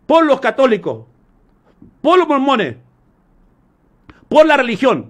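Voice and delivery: loud, measured proclamation with long pauses; forceful declamation. Acted. Jesus to the Samaritan woman about true worship, loud declamation. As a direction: proclaim each phrase and let it ring.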